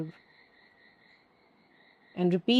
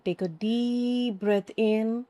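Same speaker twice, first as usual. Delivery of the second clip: slow breath cue; 2 semitones higher, slower than usual. Shown as a demonstration.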